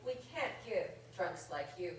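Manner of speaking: deadpan mock-formal letter read in verse; reading a mock rejection letter poem over audience laughter; comic poem reading. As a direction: read it like a snooty editor's form letter.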